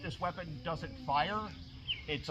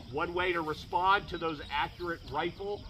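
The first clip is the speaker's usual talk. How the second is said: normal talking voice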